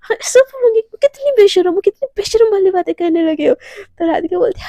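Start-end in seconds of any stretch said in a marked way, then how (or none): 0.0-3.5 high, loud outraged squeal of reproach; acted flustered scolding. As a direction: berate your partner mid pillow fight. मारने लगती है pillow से.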